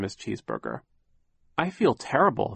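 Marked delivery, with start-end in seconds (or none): 1.6-2.5 put-on raised, mildly pained girl; a touch of faked suffering; a lightly voiced Violet. A narrator's reading. Violet faking illness to escape, mildly pained. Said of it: "Violet said".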